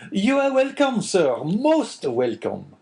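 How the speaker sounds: high, loud fawning innkeeper voice; eager innkeeper. Acted. Innkeeper Caderousse greets guest.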